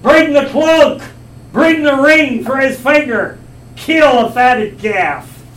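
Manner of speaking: loud, high, joyful commands to the servants; overjoyed father shouting for a feast; father's shout. Acted. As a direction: call it out across the yard, slow and ringing. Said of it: "he shouts out".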